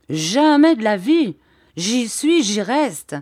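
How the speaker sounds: loud, high, stubborn outburst; defiant refusal. Acted. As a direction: plant your feet and refuse. Shepherdess refuses to leave. (refus têtu, plus fort et plus aigu)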